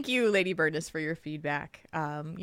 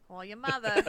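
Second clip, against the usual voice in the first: put-on New Jersey accent; 3 semitones higher, 5 dB quieter than usual. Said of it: "in that New Jersey accent".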